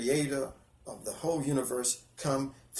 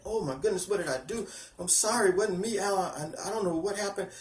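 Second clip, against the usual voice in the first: higher-pitched retold fretting; imitating scared worry; 6 semitones higher than usual. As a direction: act out her worried apology, pitched up. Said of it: "she was scared. She was worried".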